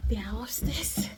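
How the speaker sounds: conspiratorial whisper; whispering before sneaking up on the family. Real, not acted. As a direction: lean in and breathe it like a secret. Surprising family, didn't tell them.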